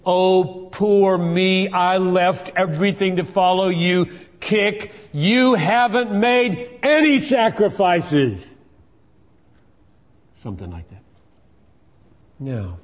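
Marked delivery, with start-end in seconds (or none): 0.1-4.1 whiny poor-me mimicry; hammed-up pitiful whine; mocking self-pity. Preacher mimicking Peter's self-pity, whining. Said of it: "self-pity". 4.4-8.6 high, loud, drawn-out rebuke; rebuking shout. Acted. Jesus loudly rebuking Peter's self-pity.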